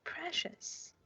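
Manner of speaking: Gollum-style gloat. Pretend. Gollum-like hiss over diamond.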